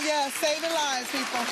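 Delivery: drawn out and proud; slow proud boast. Real, not acted. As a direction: stretch it out, proud of the job. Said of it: "proud aside about nursing".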